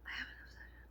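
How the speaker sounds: a hushed secret; whispered confession. Real, not acted. Vlogger confessing her obsession to viewers, whispered. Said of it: "Can I be honest with you?".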